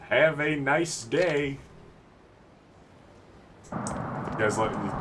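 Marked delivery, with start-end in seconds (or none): 0.1-1.6 put-on slow, stretched American accent; showy American-English phrase, slowly. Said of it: "you like my American accent".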